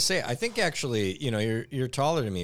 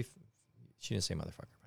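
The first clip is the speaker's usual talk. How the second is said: ordinary delivery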